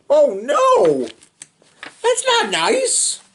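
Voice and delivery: high, slow mock outrage; shocked. Real, not acted. Reader reacting to the rude little boy, shocked. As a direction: gasp at the rudeness.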